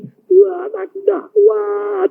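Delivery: high-pitched, drawn-out plea to God; slow, high mock whine; mock complaint. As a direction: imitate a feeble believer complaining. Mimics someone complaining to God.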